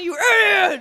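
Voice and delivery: loud, high playful insult; mock-angry shout. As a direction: yell the insult in comic outrage. Mock quarrel about frogs.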